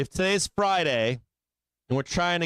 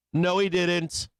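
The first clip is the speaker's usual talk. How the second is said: usual speaking voice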